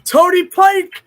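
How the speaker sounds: high, loud cry of triumph; excited shout. Real, not acted. Streamer finally lands the answer, triumphant shout. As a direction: yell the name like you just cracked it.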